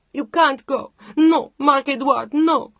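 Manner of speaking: high, loud crying protest; tearful pleading. Acted. Chantal in tears begging Mark not to leave, crying plea. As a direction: beg him through tears. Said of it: "She was in tears again".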